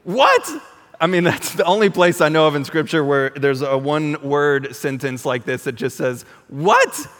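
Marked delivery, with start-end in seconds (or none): 0.0-0.5 high, loud shouted What with a rising snap; angry one-word cry of disbelief; exasperated shout. Retold or imitated. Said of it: "You just screamed". 6.5-7.1 angry one-word cry of disbelief; exasperated shout. Retold or imitated. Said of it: "Exclamation point".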